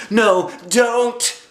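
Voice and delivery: high loud protest; high urgent no; alarmed little girl. Acted. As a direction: stop the teacher in a panic. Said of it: "said Lucille".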